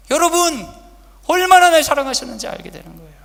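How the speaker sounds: preaching at full force. Acted. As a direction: shout the climax to the congregation. Preaching again.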